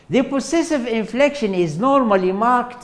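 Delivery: lightly formal lecture read; textbook reading voice. Acted. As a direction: read it off the slide like a formal definition. Reads definition from slide.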